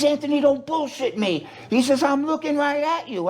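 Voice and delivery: high, insistent mock yell; retold yelling. Brother insisting he sees him on TV, mock yelling. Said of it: "And he screamed at me".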